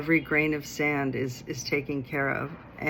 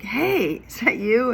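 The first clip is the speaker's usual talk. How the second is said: higher, bright surprised greeting; happy surprise; 7 semitones higher, 5 dB louder, slower than usual. Retold or imitated. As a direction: call out to a friend you just spotted. Spotting old friend on street.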